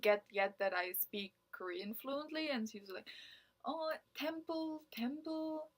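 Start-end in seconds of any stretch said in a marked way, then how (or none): 3.7-5.7 slow, higher imitation of a halting foreign speaker; mock recruiter. Imitating the cult recruiter saying temple in broken English. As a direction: lightly play the recruiter fumbling his words.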